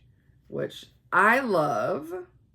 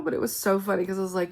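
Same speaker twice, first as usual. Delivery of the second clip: ordinary talk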